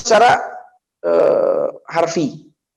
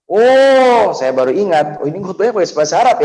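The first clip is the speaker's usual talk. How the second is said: sudden realization; 6 semitones higher, 4 dB louder than usual. Retold or imitated.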